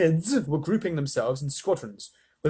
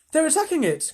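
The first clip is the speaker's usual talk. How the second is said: higher, urgent cry; alarmed boy; 5 dB louder than usual. A narrator's reading. Charlie cries out to Wonka, higher and alarmed. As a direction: call it out in alarm. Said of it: "cried Charlie".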